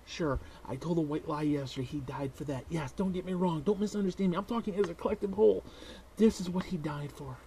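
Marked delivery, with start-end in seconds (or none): none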